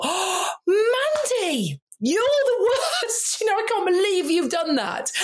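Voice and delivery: high, slow, half-laughing self-reproach; hammed-up self-telling-off, breaking into laughter; mock self-scolding. Scolding herself for bias.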